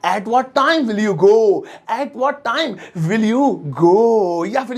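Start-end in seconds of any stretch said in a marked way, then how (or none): 0.1-1.6 slow, spelled-out English; teacher reading slowly for students; slow model sentence. Shown as a demonstration. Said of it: "अब जैसे मुझे कहना है". 1.9-4.5 very slow, word by word, a different voice; slow, syllable by syllable; slow repeat. Shown as a demonstration. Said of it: "अब जैसे मुझे कहना है".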